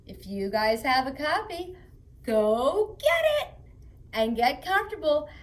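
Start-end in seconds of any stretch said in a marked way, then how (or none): 2.2-5.3 eager urging. Real, not acted. Urging kids to fetch book.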